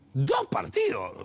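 high, slow disbelieving echo; incredulous. Retold or imitated. Reporter told to wait two matches, echoing in disbelief.

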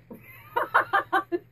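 loud, high real laugh; burst of laughter. Real, not acted. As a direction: laugh out loud, caught off guard. Amused by spirit's joke.

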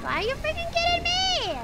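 high, loud disbelieving yell; outraged yell. Real, not acted. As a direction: shout it in exasperation. Caught by monster again.

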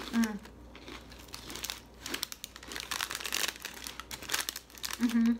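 chewing. Real, not acted. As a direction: mouth full of chip. Seen 0: Holds chip throughout; bites it at 7.9, chewing during final "Mm.

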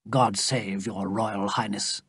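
a soft, low, sad blessing; softly and sadly. A narrator's reading. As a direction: bless her quietly, with sorrow. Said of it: "said softly and sadly".

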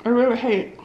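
talking through a mouthful; chewing while talking; mouth full. Real, not acted. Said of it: "to talk with my mouth so full".

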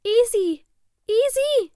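very high, loud cry; shrieked name, pitched far up; calling from afar. A narrator's reading. Sister's taped voice from the kitchen, calling out high. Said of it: "rief es aus der Küche".

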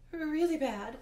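high, loud interjection; helpfully chiming in. A narrator's reading. Grover backs up his friend before Hades, helpfully chiming in. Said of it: "Grover added helpfully".

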